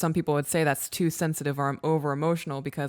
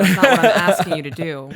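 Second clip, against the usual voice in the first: much louder, firm and insistent; emphatic louder clarification; 2 semitones higher, 11 dB louder than usual. Real, not acted. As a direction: set the record straight, loud and firm.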